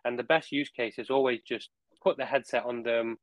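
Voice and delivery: ordinary talk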